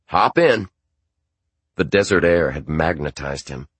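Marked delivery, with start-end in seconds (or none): 0.1-0.7 dad's voice. Acted. Dad invites narrator in.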